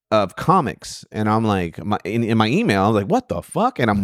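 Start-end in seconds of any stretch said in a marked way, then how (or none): 3.1-3.8 high, incredulous what the fuck; baffled outburst. Retold or imitated. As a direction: pitch jumps way up in mock disbelief. Baffled by a pile of comic videos.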